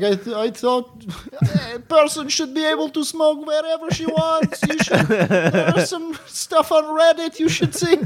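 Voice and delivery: put-on high-pitched Russian accent. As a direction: ham up a thick Russian accent. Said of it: "says something in, like, a Russian accent".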